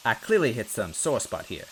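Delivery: ordinary delivery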